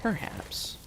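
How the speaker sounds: breathy mysterious whisper. Pretend. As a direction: hush it like a secret. Repeats word whispered, mysterious.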